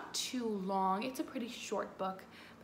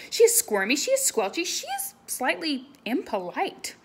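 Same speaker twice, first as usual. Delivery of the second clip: playful narration; 6 semitones higher, 10 dB louder than usual. A narrator's reading. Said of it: "squirmy, squelchy".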